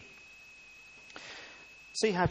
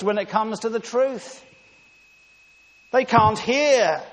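loud, higher, driving sermon voice; forceful preaching; 7 semitones higher, 11 dB louder than usual. Acted. Preacher telling the congregation the religious leaders fail the test, forceful. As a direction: press the point home hard.

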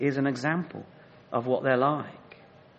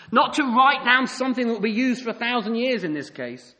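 loud, driving sermon build; impassioned preaching; 8 semitones higher, 4 dB louder than usual. Acted. Preacher describes Samson's anger to the church, with driving force. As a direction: drive it home with rising force.

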